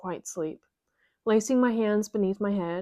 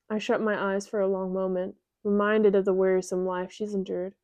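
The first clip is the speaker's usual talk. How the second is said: ordinary delivery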